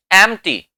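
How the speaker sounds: "एम्प्टी" as em-tee, p dropped; silent p. Shown as a demonstration. Teacher asking what to call it, p left silent. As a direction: skip the p in "एम्प्टी". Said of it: "क्या बोलेंगे इसको".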